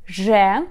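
zhe with a buzzing zh sound; letter zhe. Shown as a demonstration. Teacher modelling the letter zhe for learners, buzzing. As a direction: say zhe with a voiced buzz. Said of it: "how to pronounce Ukrainian letter".

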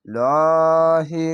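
লহি drawn out slowly with a full, heavy l. Shown as a demonstration. Teacher showing students the heavy লহি in Allah. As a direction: stretch লহি long with a heavy, rounded l. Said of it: "মোটা করা হবে".

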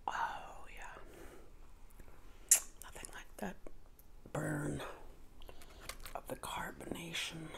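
breathy whisper, savoring the fizz. Acted. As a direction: hush it like an ASMR treat. Savoring soda on camera.

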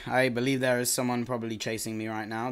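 usual speaking voice

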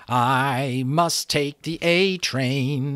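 slow, polite, lightly voiced butterfly; a gentle butterfly's polite goodbye. A narrator's reading. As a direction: announce it courteously, very slowly. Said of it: "he said politely".

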